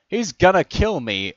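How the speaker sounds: high, loud, stretched cry; slow, loud cry of alarm; panicked yell. A narrator's reading. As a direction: yell out in terror. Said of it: "Captain Obvious yelled out".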